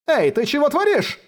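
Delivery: high furious yelp; furious howl. A narrator's reading. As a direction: howl it out in rage. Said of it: "взвыл в ярости холодец".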